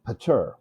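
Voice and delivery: "pater" as puh-TUR; weak first syllable, stressed second in "pater". Shown as a demonstration. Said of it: "if the accent was on the second syllable".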